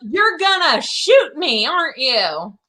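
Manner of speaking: exaggerated alarm; mock panic. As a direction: pretend to be terrified and suspicious. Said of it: "is Eileen going".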